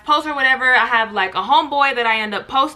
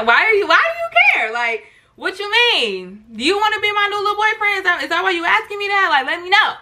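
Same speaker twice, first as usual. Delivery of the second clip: higher playful teasing; flirty teasing; 7 semitones higher than usual. Retold or imitated. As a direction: flirt back, pitched up. Flirty reply to Manny.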